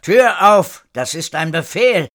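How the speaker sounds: loud, high order through a door; shouted command. A narrator's reading. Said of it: "hatte eine weitere Stimme vor der Tür gerufen". (gerufener Befehl)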